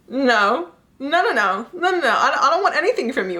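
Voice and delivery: high, laughing string of refusals; light, laughing mock protest; flustered refusal. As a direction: playfully act out a hurried brush-off. Acted refusal of offered help.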